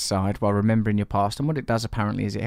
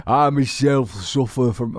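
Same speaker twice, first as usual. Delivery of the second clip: slow, drawn-out Yorkshire voice; York accent; 3 semitones higher, 4 dB louder, slower than usual. Acted. Host starts reading Rob's email in a jokey York accent. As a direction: put on a broad York accent. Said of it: "this is how people from York talk".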